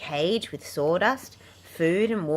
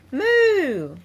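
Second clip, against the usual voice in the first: a loud, high, cartoon cow moo; playful mock moo; silly high moo; 12 semitones higher, 9 dB louder than usual. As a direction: let the puppy moo like a cow, big and high. Puppy makes a cow noise.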